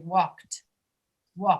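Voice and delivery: WALKED pronounced "wawkt", one syllable with final T; T-sound ending. Shown as a demonstration. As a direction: end "walked" in a voiceless k-t. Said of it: "the D will sound like a T".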